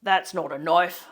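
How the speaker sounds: broad Aussie accent, mock-tough; broad Australian accent. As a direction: quote the film in a thick broad Aussie accent. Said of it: "Think Crocodile Dundee".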